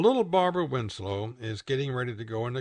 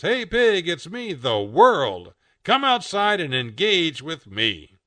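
high, loud, drawn-out hail; calling out; 5 semitones higher, 7 dB louder, slower than usual. A narrator's reading. The world invites Pig outside, calling out. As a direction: announce yourself grandly through a window.

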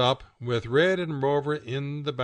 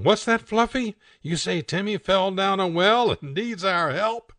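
high, louder hammed-up TV dog-owner voice; 6 semitones higher, 5 dB louder than usual. Owner asking Fluffy about Timmy, high hammed-up concern. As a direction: ham it up like an old TV show owner. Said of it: "And the voice comes in".

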